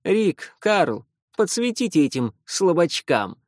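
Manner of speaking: loud bossy order; commanding. A narrator's reading. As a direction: give orders to the weaklings. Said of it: "скомандовал Лео". (командным тоном)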